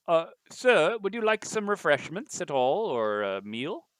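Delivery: high, polite butler tone. Acted. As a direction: serve it like a proper butler. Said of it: "a butler-sounding voice".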